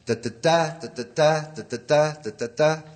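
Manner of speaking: "da-da-da" rising to the last beat; anapest beat demo. Shown as a demonstration. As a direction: chant anapests, land on the third.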